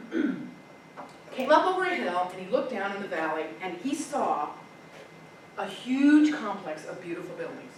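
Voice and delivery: ordinary delivery, nothing marked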